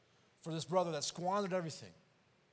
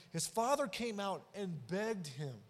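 normal talking voice

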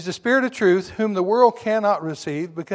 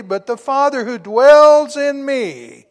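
preaching; 8 semitones higher, 12 dB louder, slower than usual. Acted. Preaching builds on scripture.

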